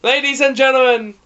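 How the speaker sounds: slow, loud, drawn-out ring introduction; ring announcer. Pretend. Introducing the carrot mascot like a ring announcer. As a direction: announce him to the arena.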